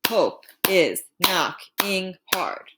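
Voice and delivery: slow, syllable by syllable; counting syllables slowly. Shown as a demonstration. Teacher counts the syllables in "Hope is knocking hard", slowly. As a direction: tap out each syllable slowly. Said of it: "last line is gonna be five syllables".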